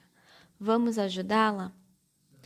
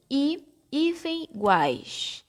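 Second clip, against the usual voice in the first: "i, hífen, iguais" in separate pieces, spelling out i-guais; syllable by syllable; 4 semitones higher, slower than usual. Shown as a demonstration. Teacher separating the word iguais for pupils, piece by piece. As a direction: say each piece apart: i, hyphen, guais. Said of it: "quando eu vou separar".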